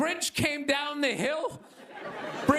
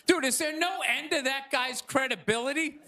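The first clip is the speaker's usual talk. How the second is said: ordinary talk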